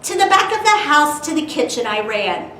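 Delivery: lively storytime narration with bouncy rhyme; animated read-aloud voice; reading to children. A narrator's reading. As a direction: read it like a picture book to kids.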